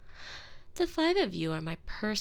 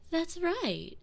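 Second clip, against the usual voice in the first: high, sing-song mock approval; mock praise; 11 semitones higher than usual. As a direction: praise them like slow children, sweetly high. Mock praise to silent maids.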